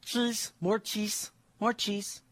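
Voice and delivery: put-on high, soft little-girl begging; toddler voice. Dad imitating his daughter Isabel asking for cheese, high toddler whine. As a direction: whine it like a small child. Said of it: "She always wants".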